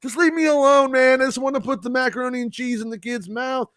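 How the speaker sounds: high, pleading mock complaint; exasperated parent. Parent feeding kids macaroni, exasperated high plea. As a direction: beg to be left alone, pitched high.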